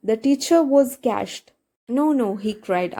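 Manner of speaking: reads the English text slowly, word by word; slow text reading. Acted. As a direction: spell it out slowly for the students. (പതുക്കെ വായിക്കുന്ന പാഠം)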